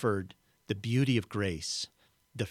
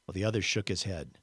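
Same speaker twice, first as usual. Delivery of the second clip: ordinary delivery, nothing marked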